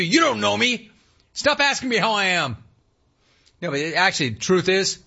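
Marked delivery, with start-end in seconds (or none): 0.0-2.6 high mock-irritated retort; mock annoyed. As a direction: snap back at the clerk, pitched high. Host voicing annoyed customer.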